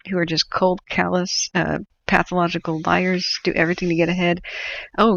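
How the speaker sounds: ordinary talk, nothing marked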